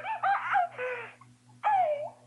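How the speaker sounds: very high, loud squealed "oh"s; mock swooning shrieks; overwhelmed woman. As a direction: squeal each "oh" as if overcome. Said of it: "She was like".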